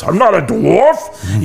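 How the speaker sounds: slow, high-pitched mock dwarf growl; lightly done Gimli impression for a laugh; John Rhys Davies Gimli voice. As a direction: ham up a movie dwarf, drawn out. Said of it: "when he does this".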